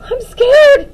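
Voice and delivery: high, frightened little voice; small shaky voice. Acted. Daphne afraid of the spider, small shaky voice. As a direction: tremble with fear. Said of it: "in a small, shaky voice".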